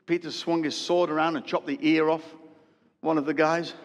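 ordinary talk

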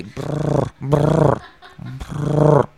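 rolled purring brr, a little loud; purring demo. Shown as a demonstration. Showing listeners how to purr, rolled brr. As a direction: purr like a cat. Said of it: "ronronees así".

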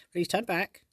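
high, urgent little sprite; fairy sprite voice. Acted. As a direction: plead like a tiny fairy. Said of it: "the sprite said".

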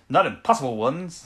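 imitated defiant line, a bit northern; slightly northern accent. Host quoting a reply to orders, slips slightly northern. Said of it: "went a bit northern at the end".

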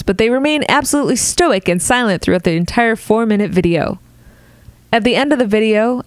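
usual speaking voice

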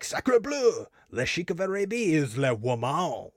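high shocked French exclamation; hammy French surprise; French accent. Retold or imitated. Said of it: "He's like".

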